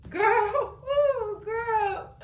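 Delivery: high wailing laugh; laugh-cry in disbelief; high giggling wail. Real, not acted. Crying laugh at dirty cops.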